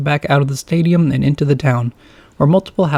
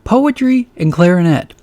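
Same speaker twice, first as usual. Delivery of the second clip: slow, higher stress on Poetry and clarinet; horrified emphasis; 5 semitones higher, 4 dB louder than usual. A narrator's reading. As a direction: spell out Poetry and clarinet in dismay. Wirt panicking about his tape.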